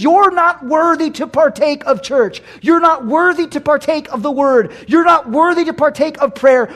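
high, shouted condemnation; accusing voice. Acted. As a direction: hammer out each accusation at full force. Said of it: "it's like that voice that says".

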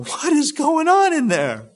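high, loud, incredulous question; baffled exclamation. Acted. Egyptians overhearing Joseph weep, loudly baffled. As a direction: burst out like a startled bystander.

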